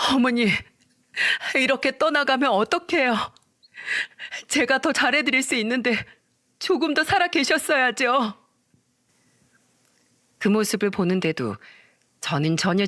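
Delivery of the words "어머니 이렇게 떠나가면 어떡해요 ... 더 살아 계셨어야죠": higher, mock-tearful daughter-in-law wail; fake wailing. Wife fakes tears for her mother-in-law, higher, mock wailing. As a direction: put on a showy grieving cry for the dead mother-in-law. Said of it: "오열하더니 이렇게 말했죠".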